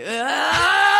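long, high, loud mock scream; imitated frustrated yell. Co-host acting out riled-up coworker, long mock scream. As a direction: let out a big wordless "ah" in imitation. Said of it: "So he would just do this".

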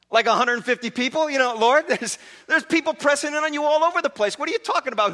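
high, loud, laughing disbelief; incredulous disciples. Acted. Disciples laughing at Jesus over the crowd, loud and incredulous. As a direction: scoff as if the question were absurd.

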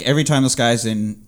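ordinary talk

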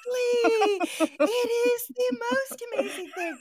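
very high squeal, breaking into laughter; gleeful agreement. Real, not acted. Host agrees with guest about living alone, squealing with glee. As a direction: squeal it through laughs, delighted.

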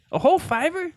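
put-on high, laughing kid voice; old-timey kid. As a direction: gasp it like a wide-eyed boy. High mock voice.

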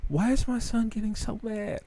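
high, soft imitation of a worried mother; mom's voice. Son imitating his mom in the car, high and soft. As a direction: ask it like his mom, puzzled.

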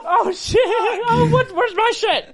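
very high, loud startled cry; surprised shout. Real, not acted. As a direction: yelp in surprise. Caught in the commotion.

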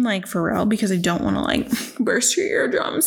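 usual speaking voice

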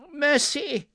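high, loud plea for mercy; terrified cry. A narrator's reading. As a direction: cry out in terror. Scrooge falls to knees.

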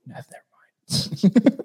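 whispered, slow brush-off. Retold or imitated. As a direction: hush it away slowly. Killer covering up.

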